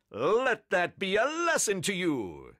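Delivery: high, booming superhero proclamation; Captain Underpants. A narrator's reading. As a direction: declaim it like a pompous cartoon hero. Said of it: "cried Captain Underpants".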